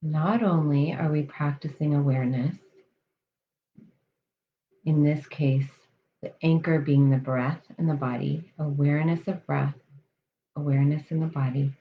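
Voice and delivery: calm, measured meditation voice with long pauses; guided meditation. Real, not acted. Coach leading listeners through breath awareness, guided meditation. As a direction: settle them into the breath, unhurried and even.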